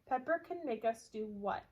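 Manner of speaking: ordinary delivery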